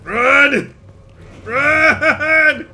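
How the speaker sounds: high, loud panicked shouts; being chased. Real, not acted. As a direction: yell while fleeing. Enemies chasing him.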